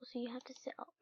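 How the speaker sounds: soft whisper. Real, not acted. Player working out the command, whispered. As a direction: whisper the tip to yourself.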